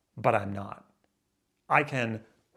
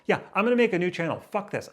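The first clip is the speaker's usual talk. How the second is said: defiant; 7 semitones higher, 4 dB louder, faster than usual. Real, not acted. Sudden defiant decision.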